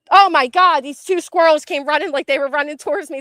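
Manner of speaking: loud, high, breathless panic; scared and shaken. Real, not acted. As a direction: still reeling from the fright. Just scared by charging squirrels.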